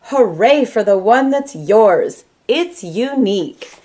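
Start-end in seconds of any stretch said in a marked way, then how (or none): none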